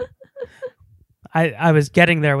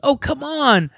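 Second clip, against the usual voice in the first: high-pitched mock protest; imitated best friend; 9 semitones higher than usual. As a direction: mimic the friend complaining, pitched way up. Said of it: "her best friend was like".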